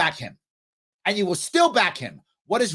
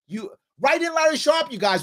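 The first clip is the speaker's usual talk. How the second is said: heated call-out; 7 semitones higher than usual. Real, not acted. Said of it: "I was yelling and screaming".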